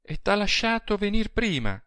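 high, anxious woman's question; distressed high-voiced woman; growing anguish. A narrator's reading. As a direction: ask with rising dread, pitched high. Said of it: "rispose ella con crescente angoscia".

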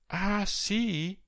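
high, drawn-out exclamation without volume; exclaiming. A narrator's reading. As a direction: exclaim in pointed surprise. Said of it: "esclamò Fulvia".

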